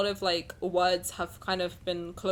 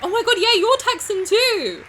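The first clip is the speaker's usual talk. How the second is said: high, loud delighted exclaim; excited; 12 semitones higher, 10 dB louder than usual. Real, not acted. Learning a friend is Texan too, loud high excitement. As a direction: burst out with thrilled surprise.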